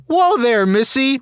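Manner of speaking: high-pitched startled mock yell. Groggy patient to wife grabbing the fly, yelling. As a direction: call it out high in put-on alarm. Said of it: "and I yelled".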